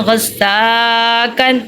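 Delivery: غصاکن with a long "saa", short "kan"; lengthened middle vowel. Shown as a demonstration. Teacher modelling the lengthening rule, drawn-out middle vowel. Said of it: "اس کو ہم لمبا کرتے ہیں".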